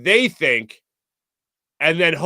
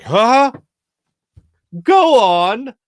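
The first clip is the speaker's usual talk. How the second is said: louder and higher eager huh; surprised; 8 semitones higher, 6 dB louder, much slower than usual. Retold or imitated. Young TV worker perks up at the baseball talk, higher and louder. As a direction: pounce on the topic, suddenly keen. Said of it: "And I'm like".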